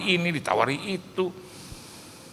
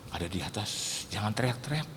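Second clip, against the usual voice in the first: much softer, lower hush; hushed warning; 7 semitones lower, 11 dB quieter than usual. Retold or imitated. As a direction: keep your voice down. Telling guest to hush. (berbisik menegur)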